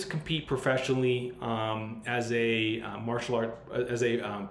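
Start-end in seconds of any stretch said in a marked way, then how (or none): none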